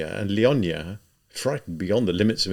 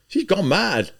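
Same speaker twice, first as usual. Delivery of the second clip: bystander; 10 semitones higher, 4 dB louder, slower than usual. A narrator's reading.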